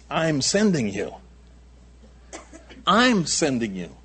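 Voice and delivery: "I'm" leaned on and drawn out, falling through "sending you"; stress on I'M. Shown as a demonstration. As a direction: put the weight on "I'm", slowly. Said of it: "the emphasis is not lambs among the wolves".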